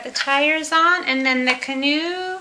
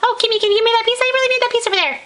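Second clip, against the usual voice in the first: high mom voice; 7 semitones higher, much faster than usual. Pretend. Voicing Lego mom figure.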